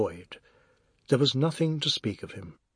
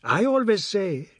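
wifely scolding; 7 semitones higher, 4 dB louder than usual. A narrator's reading. Wife scolds husband over food.

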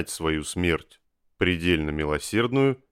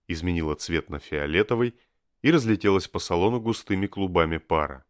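plain reading voice

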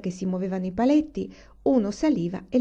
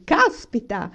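loud, raised burst of amazement; exclamation; 5 semitones higher, 6 dB louder, slower than usual. A narrator's reading. Papa opens his suspenders, loud amazed exclamation. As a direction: exclaim it in sudden wonder. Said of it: "esclamò".

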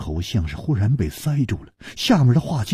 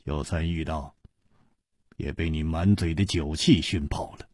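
冷冷地; 5 semitones lower, 7 dB quieter than usual. A narrator's reading. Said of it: "姬冰燕冷冷地说".

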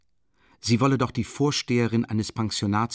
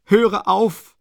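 louder and higher, panting out the stop; breathless cry; 10 semitones higher, 9 dB louder than usual. A narrator's reading. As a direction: cry it out harder, gasping. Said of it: "keuchte sie hastig, außer Atem". (atemlos, lauter und höher gerufen)

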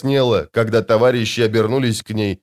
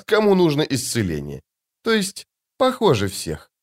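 much higher, light and airy lady's voice; sweet woman's voice; 7 semitones higher than usual. Acted. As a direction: play the serene, otherworldly beauty.

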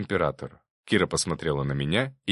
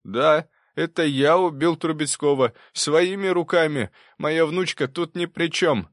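high, raised-voice confession, slowing at the end; professor confessing, emphatic; 9 semitones higher, 5 dB louder than usual. Acted. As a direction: insist on your guilt to clear your granddaughter. (настойчивое признание профессора, повышенным голосом)

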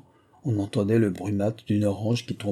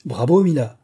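loud, higher cheer; cried out; 4 semitones higher, 6 dB louder than usual. A narrator's reading. Prince cheering Mila on with the hose, crying out. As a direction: call out louder, pitch raised. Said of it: "cria le prince".

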